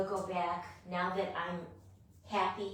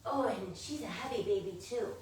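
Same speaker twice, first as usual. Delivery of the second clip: ordinary talk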